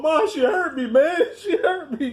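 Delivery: high, laughing mock whine to mom; hammed-up hurt son calling his mother; mock whimper. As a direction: play the heartbroken son, half-laughing. Crying to mom, retold.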